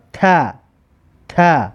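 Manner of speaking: "ت" sounded as "ta"; ta with fatha. Shown as a demonstration. Teacher models the letter with fatha for learners, "ت" as "ta". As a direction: say "ت" as a separate open syllable "ta". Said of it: "در بالاش فتحه که دارد".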